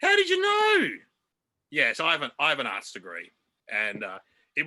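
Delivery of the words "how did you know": very high, loud shriek of disbelief; hammed-up astonished squeal; mock amazement. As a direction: act stunned by a correct guess. Mock amazement at correct guess.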